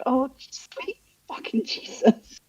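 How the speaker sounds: high, slow, crying mock despair; half-laughing mock cry; despairing groan. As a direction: wail it like you're at the end. Reacting to blood clot news.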